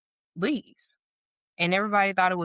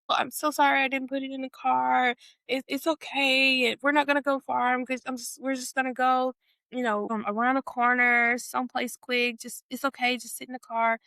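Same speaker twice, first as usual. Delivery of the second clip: higher-pitched apologetic reassurance; acted placating; 6 semitones higher than usual. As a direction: imitate a friend smoothing it over. Imitated friend placating about car seat.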